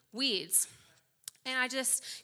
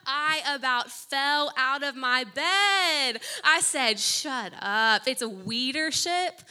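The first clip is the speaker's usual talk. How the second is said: much louder, thrilled disbelief; excited shout; 3 semitones higher, 10 dB louder, slower than usual. Real, not acted. Reacting to the friend's news, loud and astonished.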